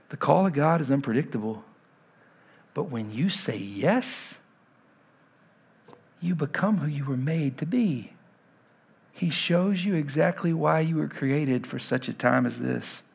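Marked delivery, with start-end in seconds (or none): 0.1-11.7 much softer, lower, tender sermon voice; hushed intimate preaching. Acted. Pastor voicing Jesus's call to the congregation, hushed and tender. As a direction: pull the room in close and quiet.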